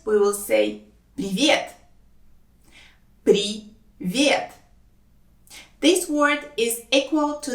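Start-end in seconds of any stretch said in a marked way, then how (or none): 3.2-4.5 slow «При... вет» with a gap; syllable by syllable. Shown as a demonstration. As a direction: say «Привет» as two separate syllables. Teacher breaks word into syllables.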